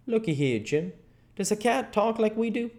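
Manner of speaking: lightly voiced boy, pitch lifting; Huck, reasoning. Huck quizzes Jim about cats talking, reasoning. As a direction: lead him into the argument. Huck begins his argument.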